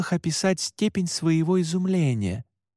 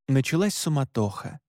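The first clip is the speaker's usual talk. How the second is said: usual speaking voice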